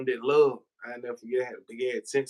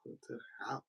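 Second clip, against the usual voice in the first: "Pull up to the house" whispered without voice, much softer; whispered echo; 16 dB quieter than usual. Shown as a demonstration. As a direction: repeat "Pull up to the house" as a hushed, voiceless aside. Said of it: "whispered repeat".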